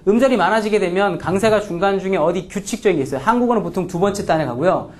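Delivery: normal talking voice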